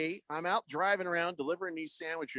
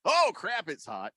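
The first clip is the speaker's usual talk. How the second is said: exclaimed realization; 4 semitones higher than usual. Shown as a demonstration. Radio host rating the next level of heat, exclaimed realization. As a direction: burst out, then sink on the last words. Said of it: "One ten is".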